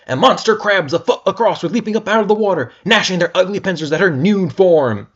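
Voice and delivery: excited narration. A narrator's reading. Narration rising with horror.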